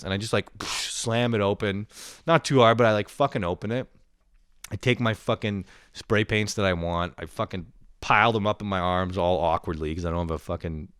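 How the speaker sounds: ordinary delivery, nothing marked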